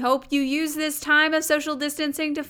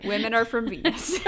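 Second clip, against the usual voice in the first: said through a laugh; 3 semitones higher, 6 dB louder than usual. Real, not acted. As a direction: let a laugh break into the line. Joking about the book title.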